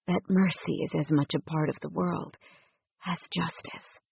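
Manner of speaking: softly murmured to the woman in his arms; whispered. A narrator's reading. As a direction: say it softly close to her ear. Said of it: "he whispered".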